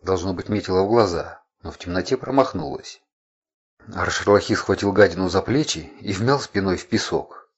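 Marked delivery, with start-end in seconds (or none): none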